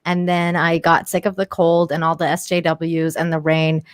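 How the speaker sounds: normal talking voice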